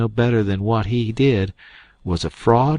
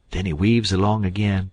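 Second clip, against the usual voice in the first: ordinary delivery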